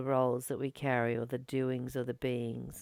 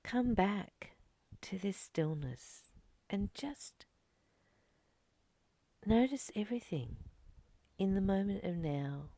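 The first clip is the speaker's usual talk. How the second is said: guided meditation; 3 semitones higher, 4 dB quieter than usual. Acted. Teacher guiding her students back to stillness, softly whispering. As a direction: coax them gently into noticing the moment.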